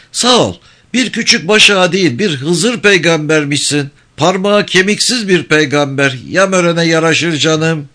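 high, sneering village elder; mock praise, dripping with scorn; mocking old man. Topal İsmail mock praise. (alaycı, tiz sesli yaşlı ağa)

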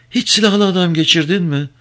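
mother probing the ferryman about armed men; anxious old woman. Acted. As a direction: ask carefully, an old woman fearing the answer. Old Zine asks the ferryman.

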